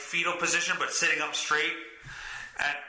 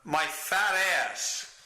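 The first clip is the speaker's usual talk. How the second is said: ordinary talk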